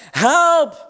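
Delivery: long, loud, high cry of help; shouting help. Retold or imitated. Drowning swimmer shouting for his friends. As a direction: yell it so they hear you. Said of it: "heel hard 'Help!' roepen".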